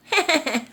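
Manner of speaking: short high gleeful laugh; cheeky laugh. Acted. Musubi man laughing at the dog, high glee. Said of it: "the musubi man only laughed".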